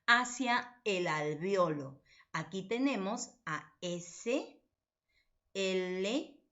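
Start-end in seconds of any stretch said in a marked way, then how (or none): none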